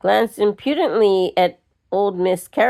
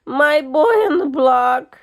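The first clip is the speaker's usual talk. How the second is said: distressed lament; 6 semitones higher than usual. A narrator's reading. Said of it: "Anne moaned distressfully".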